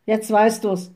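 loud, brusque forester retort; snapping. A narrator's reading. Forester answers the witch's ignorance, snapping. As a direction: snap it at a trespasser. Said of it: "schnauzte der Förster".

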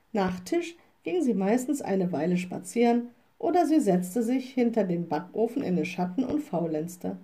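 ordinary delivery

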